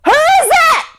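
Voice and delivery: very high, loud scared call; frightened cry. Acted. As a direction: shout into the dark, scared. Jake cries out frightened.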